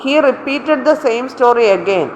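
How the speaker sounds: slow dictation of the faulty sentence, again included; reading the wrong version. Shown as a demonstration. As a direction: read the error version out slowly, word by word. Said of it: "repeat ചെയ്യുക എന്ന് പറഞ്ഞാൽ again ചെയ്യുക എന്നാ".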